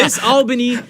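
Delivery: loud, high name call; shouting out names. Real, not acted. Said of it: "just shouting their names out".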